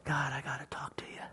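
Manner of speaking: soft unvoiced whisper; talking quietly with God; whispered prayer. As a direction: pray in a hush. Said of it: "I can even go to my God and say".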